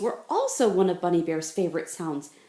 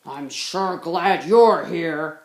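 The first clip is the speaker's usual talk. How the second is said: slow, very loud burly voice; big bear voice; 9 dB louder, slower than usual. Acted. Grizzly Bun booming that she is a bear. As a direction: plant your feet and boom.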